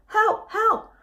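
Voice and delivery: a father calling for his lost pup; frantic cry for help. Acted. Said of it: "This meant".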